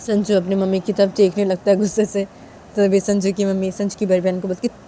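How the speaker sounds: plain reading voice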